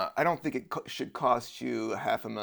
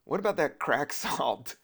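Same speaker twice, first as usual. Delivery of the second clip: ordinary talk, nothing marked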